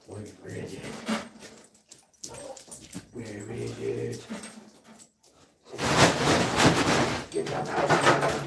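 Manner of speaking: hushed whisper while hunting. Real, not acted. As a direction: whisper so the mouse won't hear. Sneaking up on a mouse.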